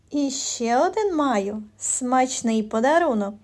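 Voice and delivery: ordinary delivery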